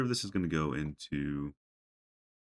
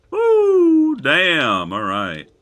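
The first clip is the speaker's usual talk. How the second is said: shocked shout; 12 semitones higher, 15 dB louder, much slower than usual. Real, not acted. Recovering from the jump scare.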